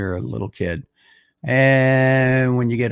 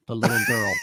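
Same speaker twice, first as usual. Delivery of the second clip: sarcastic; faster than usual. Real, not acted. Sarcastic answer.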